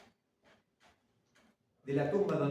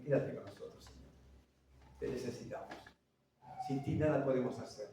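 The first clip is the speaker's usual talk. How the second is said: soft prayer; 4 semitones lower, 6 dB quieter than usual. Acted. Congregation prayer voiced softly.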